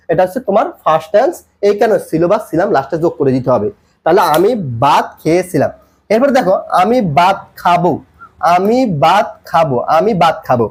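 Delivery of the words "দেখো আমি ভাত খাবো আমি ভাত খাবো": slow, word by word; slow model phrase. Shown as a demonstration. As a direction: draw out each word for learners. Teacher models the translation slowly. (ধীরে, শব্দ ভেঙে ভেঙে)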